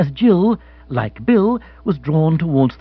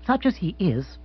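soft, apologetic aside; a little embarrassed, excusing him; 4 semitones higher, 6 dB quieter than usual. Acted. Monica introduces her husband, softly apologetic. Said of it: "said Monica apologetically".

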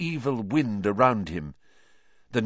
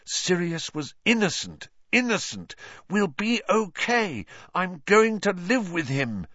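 high, insistent thoughts; cling to the hopeful thought; desperate self-reassurance; 7 semitones higher than usual. A narrator's reading. Fighting to hold happy thought.

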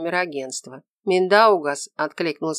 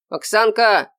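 loud, higher call of a name; shouted; 4 semitones higher, 6 dB louder, slower than usual. A narrator's reading. Orderly calls to the patient as she enters, shouting. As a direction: cry out to a friend. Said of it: "закричала она".

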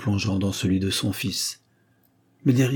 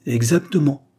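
higher, louder firm agreement; 6 semitones higher, 5 dB louder than usual. Acted. Father firmly approving his son's answer. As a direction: snap it out with conviction. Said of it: "d'une voix ferme".

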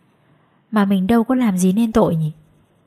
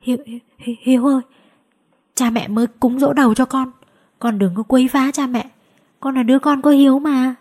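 stammering; 6 semitones higher than usual. A narrator's reading. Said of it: "bà Hương lắp bắp".

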